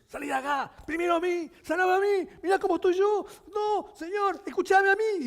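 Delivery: high, loud pushy yelling; crowd shouting at Jesus. Retold or imitated. Desperate crowd yelling at Jesus to be healed first, high and loud. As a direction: play the shoving crowd, each demanding to be first. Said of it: "Estaban a los gritos".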